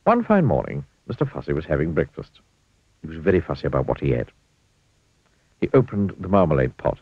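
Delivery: plain reading voice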